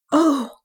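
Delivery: sharp high gasp of shock; startled gasp. A narrator's reading. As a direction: gasp as you come to. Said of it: "he gasped".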